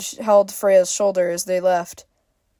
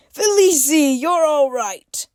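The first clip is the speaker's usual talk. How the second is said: relieved chuckling father; 8 semitones higher, 4 dB louder than usual. A narrator's reading. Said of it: "he chuckled".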